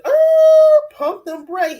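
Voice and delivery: high, loud "pump them brakes"; loud mock warning. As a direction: shout it like slamming the brakes. Quoting Juan telling Susan to slow down.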